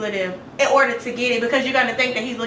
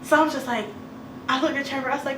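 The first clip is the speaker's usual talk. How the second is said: ordinary delivery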